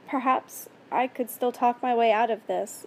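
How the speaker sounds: usual speaking voice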